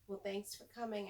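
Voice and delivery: usual speaking voice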